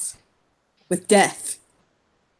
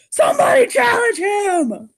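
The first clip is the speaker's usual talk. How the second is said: eager shout; 12 semitones higher, slower than usual. Acted. Demanding a challenge.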